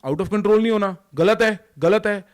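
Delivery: loud high scolding; loud, high scolding elders; scolding parent. Retold or imitated. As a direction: scold the child sharply. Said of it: "सबने बोला".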